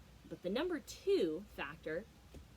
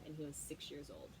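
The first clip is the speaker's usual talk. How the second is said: normal talking voice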